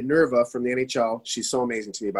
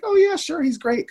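much higher, louder, bubbly yes; eager agreement; 14 semitones higher, 4 dB louder than usual. Retold or imitated. Wife eagerly agrees to the photo trade, bright and much higher. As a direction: chirp it like a delighted fan.

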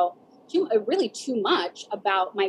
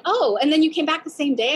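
higher, louder mock astonishment; amazed friend; 7 semitones higher, 5 dB louder than usual. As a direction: imitate a friend's incredulous question. Voices amazed East Coast friends.

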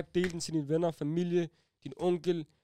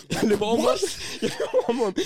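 laughing; 6 semitones higher, 7 dB louder than usual. Retold or imitated. Laughing retelling of chase.

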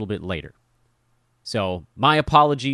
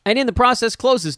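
exasperated rise; 13 semitones higher, 5 dB louder than usual. Real, not acted. Self-mocking rant climax.

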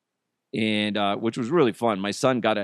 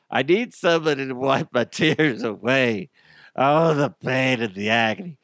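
hammed-up fake anguish with a laugh; mock crying. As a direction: ham up the agony over a sore voice. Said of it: "I'm crying is what, what's happening".

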